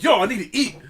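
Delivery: loud, high complaint with a laugh; mock-exasperated plea; impatient hungry customer. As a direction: imitate a customer fed up with waiting.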